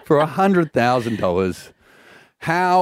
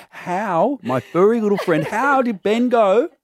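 talking to a pet; 9 semitones higher than usual. Acted. Host addressing the ferret mascot.

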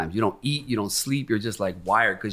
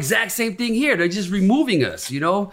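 higher, heated retold outrage; indignant rant; 8 semitones higher than usual. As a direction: rail against being pushed out. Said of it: "I'm like".

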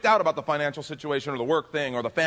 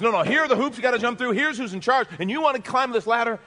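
louder, higher and fast, insistent; the world's orders; 10 semitones higher, 6 dB louder, faster than usual. Retold or imitated. Pastor voicing the world's ladder-climbing demands, fast and loud. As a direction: hammer out the rules of the rat race.

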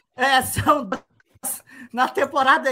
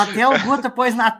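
ordinary delivery

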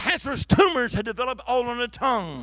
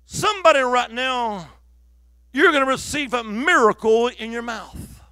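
loud, slow, raised declaration; prophetic proclamation; 4 semitones higher, 6 dB louder than usual. Retold or imitated. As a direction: proclaim it slowly over the crowd. Retells calling out a prophecy.

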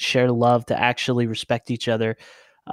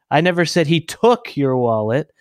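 "took" punched, other words unstressed; stress on TOOK; 2 semitones higher, 3 dB louder than usual. Shown as a demonstration. Showing how emphasis changes meaning, stress on "took". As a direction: hit the verb "took". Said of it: "put emphasis on a different word".

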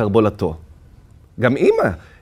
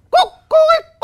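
very high, loud crowing cock-a-doodle; rooster crow; 6 dB louder than usual. A narrator's reading. The father turned rooster crowing in the room. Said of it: "וצווח שוב".

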